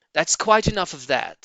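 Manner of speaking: a hint of a stern father. A narrator's reading. Mr Fox stopping his son drinking.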